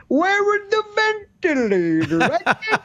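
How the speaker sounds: put-on very high, sing-song almost-Italian accent; hammed-up lilting politician with an Italian-ish accent; Andrew Cuomo accent. Governor hunting for ventilators, mock almost-Italian accent. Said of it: "some kind of an almost Italian accent".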